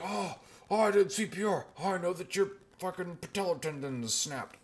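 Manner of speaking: high, hammed-up self-important voice; mock someone showing off their first-aid training; mock know-it-all. CPR-trained bystander announces a snapped tendon, mock self-important. Mocking instant medical experts.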